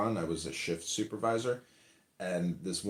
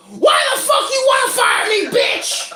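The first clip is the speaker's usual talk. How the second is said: much higher, much louder screaming insult; furious outburst; 14 dB louder than usual. Retold or imitated. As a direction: blow up at her in a rage. Worker yells at boss instead.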